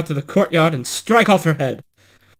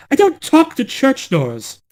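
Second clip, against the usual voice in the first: indignant; 10 semitones higher than usual. Acted. False bride acts indignant.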